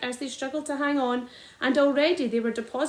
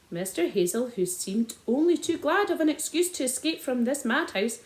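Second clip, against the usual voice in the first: plain reading voice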